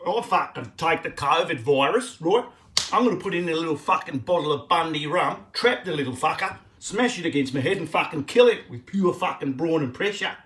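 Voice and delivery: loud, broad Queensland Australian accent; Queenslander accent. Shown as a demonstration. Comic playing a Queenslander vowing to smash the virus, in a loud Queensland accent. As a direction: belt it out as a brash Queenslander.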